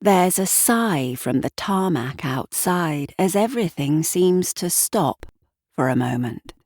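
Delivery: usual speaking voice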